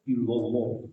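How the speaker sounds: ilallah said low with a rounded o vowel. Shown as a demonstration. Teacher quoting the master's way, low round ilallah. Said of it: "nyebut ilallah yang bulat".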